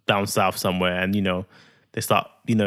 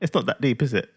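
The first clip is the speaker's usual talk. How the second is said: ordinary delivery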